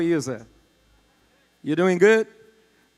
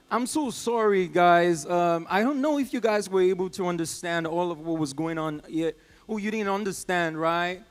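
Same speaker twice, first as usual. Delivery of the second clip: ordinary talk, nothing marked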